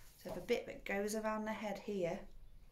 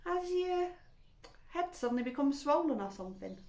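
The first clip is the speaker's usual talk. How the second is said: put-on innocent teasing girl; 8 semitones higher than usual. A narrator's reading. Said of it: "she said".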